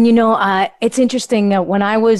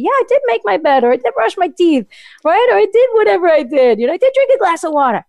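upbeat self-talk; 7 semitones higher, much faster than usual. Retold or imitated. Voicing a positive self-talk.

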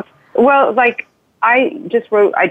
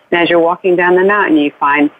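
ordinary talk, nothing marked

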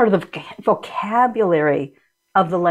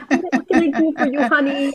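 put-on high, quick coaxing voice for a small child; laughing-edged parent voice, higher than usual; talking to a kid; 5 semitones higher than usual. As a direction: ask it like soothing an upset kid, pitched up. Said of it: "You have to say to the kid".

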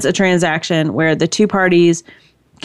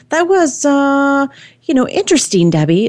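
mother's polite reaction; 9 semitones higher than usual. Retold or imitated. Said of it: "she was kinda".

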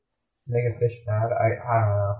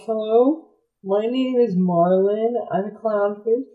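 somewhat higher, slow, lightly voiced; Marlin; 8 semitones higher, slower than usual. Marlin greeting the shark group, higher and polite. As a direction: introduce yourself politely. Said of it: "I'm like".

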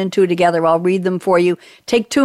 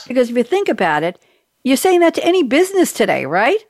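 ordinary talk, nothing marked

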